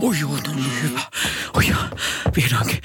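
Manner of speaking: low contented sigh of relief. Acted. Father relieved the yogurt finally arrived, low and pleased. Dad tasting yogurt, delighted.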